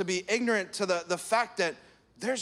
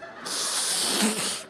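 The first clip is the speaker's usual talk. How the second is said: shaky exhale. Retold or imitated. Child calming, shaky breath.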